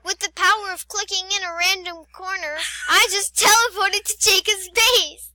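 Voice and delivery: high, loud showman's proclamation; mock grand announcement. Player announcing a teleport to friend's base, mock grand announcement. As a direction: proclaim it like a magic trick.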